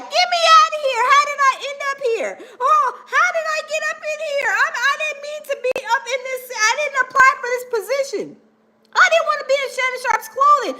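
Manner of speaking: high frantic little voice of the seams; screaming seams. Pretend. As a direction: squeal like fabric about to burst. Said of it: "the seams are screaming, and they're like".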